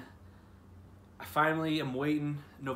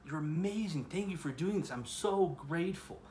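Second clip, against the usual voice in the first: mildly heartfelt thanks, pitched higher; imagined grateful prayer; 5 semitones higher than usual. Retold or imitated. Praying to God after getting the internship, gratefully acted out. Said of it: "I should have been thanking".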